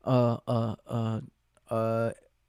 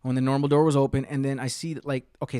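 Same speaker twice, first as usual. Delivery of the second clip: usual speaking voice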